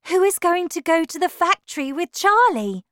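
high, loud urgent question; agitated cry. A narrator's reading. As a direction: call out the pressing question. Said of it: "cried Missus Bucket".

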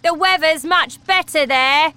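father waving off his daughter; gruff father. Acted. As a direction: brush her off loudly.

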